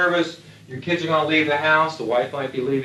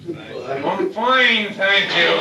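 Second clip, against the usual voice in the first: higher, breezy mock I'm fine; older troop's denial; 4 semitones higher, much slower than usual. Older pilots brushing off the interviewer, mock breezy denial. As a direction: brush it off like a veteran hiding feelings. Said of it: "they would say".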